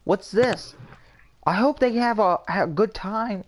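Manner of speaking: high, airy mock voice of a girl chattering through a movie; chatty girlfriend. Mocking how Valerie chatters through the whole movie, high and airy. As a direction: mimic her pestering questions in a high voice. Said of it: "she would sit there and talk through".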